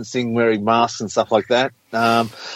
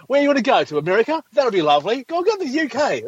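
high, singsong sarcastic suggestions; mock the moaner with fake cheer; 11 semitones higher than usual. Scoffing at a lockdown moaner, high sarcastic singsong.

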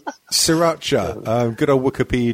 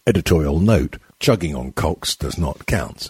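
mock editorial announcer; 10 semitones lower than usual. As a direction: drop low for a solemn editor's note.

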